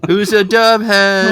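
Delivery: high, slow, loud goofy voice; goofy character voice. Pretend. Hosts mock calling fans, in a high goofy voice. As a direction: stretch it out in a silly hosting voice.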